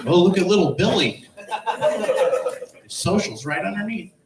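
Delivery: high, gushing proud parent; mocking gushing parent. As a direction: coo over little Billy. Mocking stick-figure car decal.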